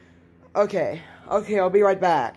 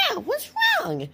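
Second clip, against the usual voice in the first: squeaky little character. Acted. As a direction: play a tiny squeaky-voiced friend. Voices the friend replying.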